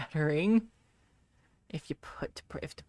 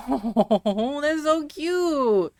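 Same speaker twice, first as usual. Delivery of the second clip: higher, slow, louder cooing "so cute"; gushing; 9 semitones higher, 7 dB louder, much slower than usual. Real, not acted. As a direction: melt over the cute husky, drawing it out. Reacting to viewer's husky clip.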